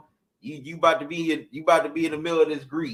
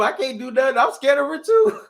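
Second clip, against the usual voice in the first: higher, pleading scared voice; mock scared owner; 9 semitones higher, 5 dB louder than usual. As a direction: play the owner admitting fear to the dog. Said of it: "you telling him".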